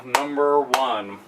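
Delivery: a hushed, drawn-out repeat of the reveal; hushed echo. Pretend. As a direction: let it sink in quietly. Dramatic reveal of favorite wrestler.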